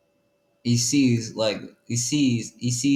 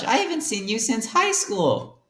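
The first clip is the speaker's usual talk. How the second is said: much higher, playful character voice; 10 semitones higher than usual. Acted. [name] recalls high school with Freddy, high voice. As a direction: squeak it like a cartoon friend.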